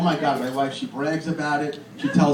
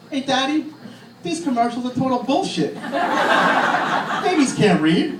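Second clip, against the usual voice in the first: put-on talking baby; 8 semitones higher than usual.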